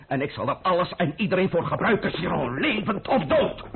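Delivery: ruthless resolve. Acted. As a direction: drive it home without mercy. Ruthless climax of speech.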